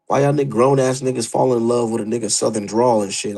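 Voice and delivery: usual speaking voice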